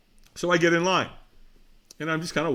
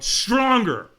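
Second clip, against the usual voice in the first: high, loud push for more; trainer's demanding shout; 9 semitones higher, 7 dB louder than usual. Retold or imitated. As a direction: imitate a trainer urging someone on. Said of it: "And he went".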